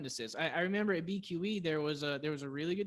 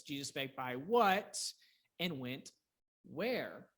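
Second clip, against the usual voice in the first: WHAT and where each set apart; weight on what and where; stress on question words; 2 semitones lower, slower than usual. Shown as a demonstration. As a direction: punch what, pause, then land on where. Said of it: "The question word".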